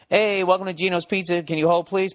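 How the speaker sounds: cheery scripted hold greeting; pizza greeter. Retold or imitated. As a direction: mimic a rushed pizza shop answering. Mimicking pizza place greeter.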